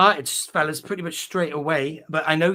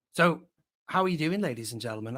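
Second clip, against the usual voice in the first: ordinary talk, nothing marked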